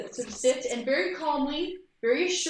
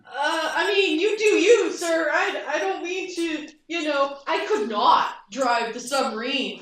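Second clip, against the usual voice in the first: higher and louder, flustered backpedal; 4 semitones higher, 7 dB louder than usual. Acted. Pilot backing down before the officer, flustered and higher. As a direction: babble out a nervous retreat.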